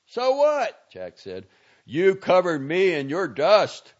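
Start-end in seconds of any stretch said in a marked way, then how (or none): 0.0-0.9 high, loud retort; shouted. A narrator's reading. Shouted exchange across driveway. 1.9-3.9 louder, slow accusing yell; yelling an accusation while driving off. A narrator's reading. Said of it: "Valenti yelled at him".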